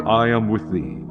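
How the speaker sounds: usual speaking voice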